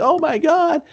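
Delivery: high-pitched mock-panicked exclamation. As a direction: squeal it like someone flailing away in fright. Acting out someone flailing off in panic.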